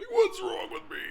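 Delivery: high, strained mock panic; anxious self-quote. Host quoting his own panic to viewers, high mock squeak. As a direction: squeak it out like you've lost it. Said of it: "I'm walking around going".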